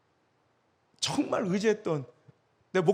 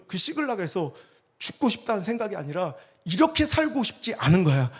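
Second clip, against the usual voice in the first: ordinary talk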